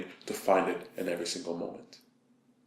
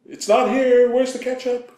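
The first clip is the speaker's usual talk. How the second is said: much higher, loud exasperated shout; frustrated yell; 6 dB louder than usual. Retold or imitated. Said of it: "I go".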